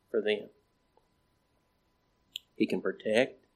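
ordinary talk, nothing marked

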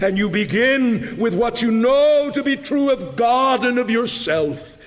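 high, impassioned pulpit declaration; full-force preaching. Acted. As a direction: ring it out like a heartfelt confession.

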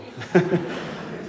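breathy high laugh; short airy chuckle at the joke. Real, not acted. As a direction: laugh at your own joke. Laughing at broken flowerpot joke.

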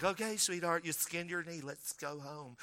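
mock-baby a child with a skinned knee; coddling parent. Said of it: "you're like".

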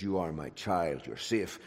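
soft, gentle fatherly reassurance; tender whisper-soft Aslan. Acted. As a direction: murmur it like a father into a child's ear. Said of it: "voice speaking into their ears".

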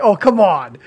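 loud, laughing disbelief; exasperated. Retold or imitated. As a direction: throw up your hands at it. Reacting in disbelief at a bad dub.

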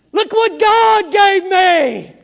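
put-on a little girl shouting "Look what God gave me!" to her daddy, slow and drawn out; joyful scream. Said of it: "you could hear screaming".

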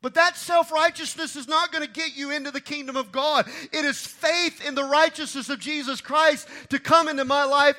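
loud, high, fervent preaching; shouted sermon climax; full-force preaching. Real, not acted. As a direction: drive it home from the pulpit.

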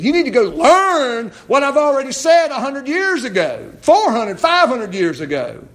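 loud, high preaching rebuke; emphatic rebuke. Acted. Preacher voices Jesus's rebuke.

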